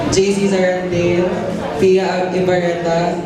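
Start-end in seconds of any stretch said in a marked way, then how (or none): none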